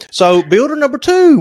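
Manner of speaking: normal talking voice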